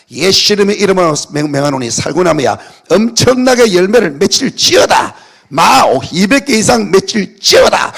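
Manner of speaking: loud preacher's decree to the tree; commanding shout. Retold or imitated.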